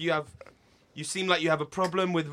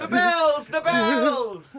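Quasimodo impression; 9 semitones higher, 6 dB louder, much slower than usual. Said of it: "another hunchback of Notre Dame reference".

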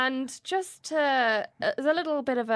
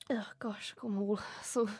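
whispering; 8 dB quieter than usual. Real, not acted. Flustered whispered aside.

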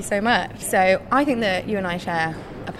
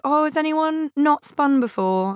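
high, bright-voiced instructor question; chirpy teacher checking on the newcomers; imitating the cheery spin teacher; 8 semitones higher than usual. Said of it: "The woman's like".

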